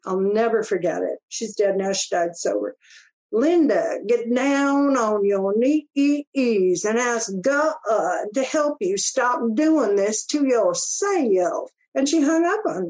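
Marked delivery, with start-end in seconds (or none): 3.3-11.7 put-on very slow, drawn-out Southern accent; Louise's Southern accent. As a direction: imitate a hard, tough woman's long Southern vowels. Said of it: "Louise had a Southern accent".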